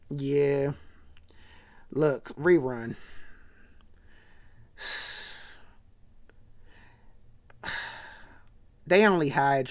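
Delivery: low, soft, hesitant letdown with a heavy sigh; reluctant bad news. Dwayne tells Rerun he was only hired to be insulted, reluctant. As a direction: imitate a friend gently breaking it, voice sinking low.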